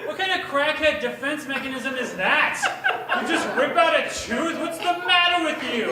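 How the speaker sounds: loud, high, laughing mock yell; mock screaming. Tutor screaming at a kid who pulled his tooth. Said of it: "And I just start screaming".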